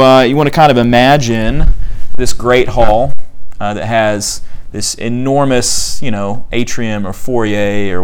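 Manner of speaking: ordinary talk, nothing marked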